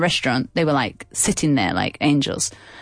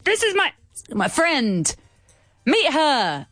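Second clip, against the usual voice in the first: put-on drunk man voice; 9 semitones higher, slower than usual. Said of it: "The man was like".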